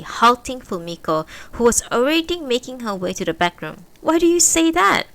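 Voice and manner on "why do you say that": loud, high challenge; demanding. A narrator's reading. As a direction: push back hard. Fumiko demands an answer.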